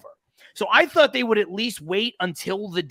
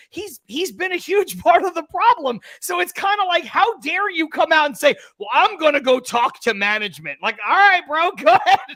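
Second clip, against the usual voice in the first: higher, louder, laughing through the rant; exasperated rant, louder to the end; heated rant; 8 semitones higher, 5 dB louder than usual. Real, not acted. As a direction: build the indignation into a laugh. Ranting about Max.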